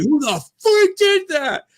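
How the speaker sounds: panicked angry guest. Retold or imitated. Guest hearing a cough, high outraged squeal. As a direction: shriek like a furious parkgoer. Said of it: "you're just like".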